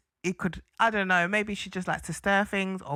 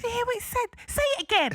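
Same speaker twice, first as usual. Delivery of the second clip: much higher, mock-innocent stirring voice; mocking Matilda; 13 semitones higher, much faster than usual. Co-host mimicking Matilda stirring trouble, high mock voice. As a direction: pitch it way up like a nosy stirrer. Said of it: "Matilda goes".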